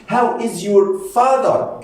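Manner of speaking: slow, lifted language-lesson delivery; slow modelling. Shown as a demonstration. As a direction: stretch each word out for learners. Teacher models question for learners.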